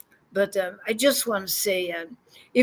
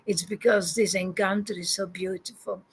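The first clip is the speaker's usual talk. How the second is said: ordinary talk, nothing marked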